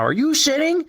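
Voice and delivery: high incredulous question; amazed question. Retold or imitated. Stranger amazed that he really sits, high incredulous. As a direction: ask it high in disbelief.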